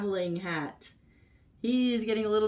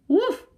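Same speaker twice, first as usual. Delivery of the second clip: much higher, louder mock bark; barked woof; 12 semitones higher, 6 dB louder than usual. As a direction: yap it out like a little dog. Dog answers duck.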